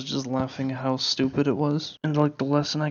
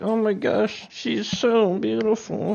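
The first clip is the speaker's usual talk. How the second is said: higher-pitched mock swooning suitor; imitating lovestruck guy; 6 semitones higher, slower than usual. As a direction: gush slowly, parodying a smitten admirer. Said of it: "walk in and be like".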